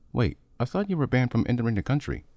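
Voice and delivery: high, startled disbelief; surprised. Acted. Brother shocked that Honda is heading home, high surprised voice. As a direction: catch him out mid-thought, alarmed.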